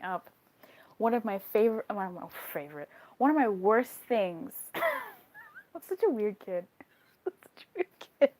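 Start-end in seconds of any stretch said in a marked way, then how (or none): 4.7-8.3 laughing. Real, not acted. Laughing at herself.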